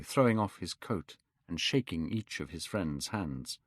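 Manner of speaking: usual speaking voice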